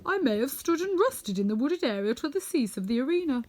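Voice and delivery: Tin Woodman voice. Acted. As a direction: speak as the Tin Woodman, stretching out his fondness.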